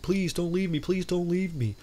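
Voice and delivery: high-pitched mock begging, a touch desperate; pleading. Stranded mercenary begging the departing plane, mock pleading. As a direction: beg them not to leave you behind, half-hearted.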